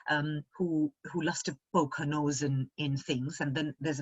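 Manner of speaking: ordinary talk, nothing marked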